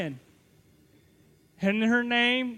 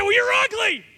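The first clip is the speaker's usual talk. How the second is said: high, loud comeback; yelled retort; 11 semitones higher, 5 dB louder than usual. Retold or imitated. Student trades insults with girlfriend's friend across campus, high loud yell. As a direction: shout back a childish insult. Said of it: "she yelled back".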